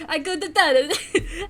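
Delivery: slow, hammed-up bad Italian accent with a laugh; mock Italian, laughing through it; bad Italian accent, half laughing. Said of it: "The bad Italian accent".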